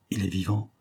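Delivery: soft, dreadful hushed question; terrible accent. A narrator's reading. Countess asks whether her lover lives, softly and with dread. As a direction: drop the voice, dreading the answer. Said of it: "trop jeune pour en soutenir l'accent".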